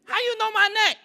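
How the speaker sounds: put-on very high little kid voice; high-pitched toddler. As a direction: squeak it like a three-year-old. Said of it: "so I had a high-pitched voice".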